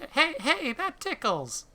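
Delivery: put-on high, loud squeal of a delighted kid; giggly child. Boy being licked by the police dog, high delighted squeal.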